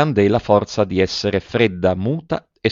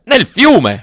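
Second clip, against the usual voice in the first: much higher, loud outraged yell; horrified shout; 13 semitones higher, 8 dB louder than usual. A narrator's reading. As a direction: scream it as if the gold were drowning. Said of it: "urlò l'avaro".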